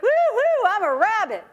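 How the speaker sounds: high, loud gleeful whooping; excited rabbit. Acted. Rabbit learns what he is and jumps around, elated. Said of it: "the rabbit is so excited".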